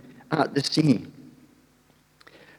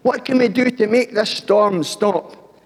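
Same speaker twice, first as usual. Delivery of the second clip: panicked shout; 8 semitones higher, 3 dB louder than usual. Acted. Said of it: "they shouted out".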